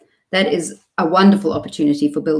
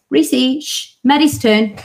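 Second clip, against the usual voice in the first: higher-pitched turn-directing mum voice; train conductor parent; 7 semitones higher, 4 dB louder, slower than usual. Retold or imitated. Parent refereeing twins in the back seat, conductor-like. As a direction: announce whose turn it is, higher and drawn out. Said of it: "think of myself as the train conductor".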